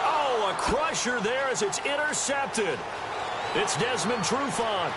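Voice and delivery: high, excited touchdown call, key words stretched; thrilled sportscaster, slowing into the big finish; excited play-by-play. Real, not acted. Live call of interception touchdown.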